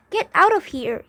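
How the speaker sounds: put-on loud, sharp snap at the kids; angry snap. A narrator's reading. Bully snapping at kids to get out. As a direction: bark it out like a bully. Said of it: "he snapped".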